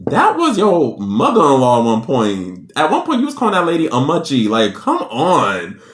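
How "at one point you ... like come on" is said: exasperated scolding. Real, not acted. The speaker scolding the ex-wife, exasperated. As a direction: rush it then drag come on in disbelief.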